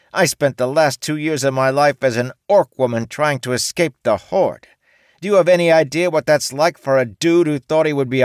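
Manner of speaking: high, loud angry rant; T venting two years of misery; ranting orc woman. A narrator's reading. T rants.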